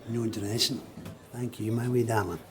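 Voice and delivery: usual speaking voice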